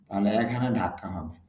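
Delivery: ordinary talk, nothing marked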